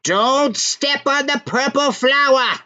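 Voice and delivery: slow, high, eerie crone's warning; slow witch voice. A narrator's reading. Witch at the cabin door warning the three friends, slow and eerie. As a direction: drawl it out like a creepy witch. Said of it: "She slowly says".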